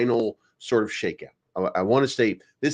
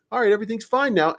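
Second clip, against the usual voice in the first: mock reassurance; 10 semitones higher, faster than usual. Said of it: "when they say".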